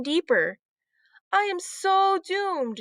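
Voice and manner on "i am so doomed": slow, higher moaning lament; gloomy despair. A narrator's reading. Heidi worrying about the lost bracelet, slow despair. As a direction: drag it out like all is lost. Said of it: "she thought".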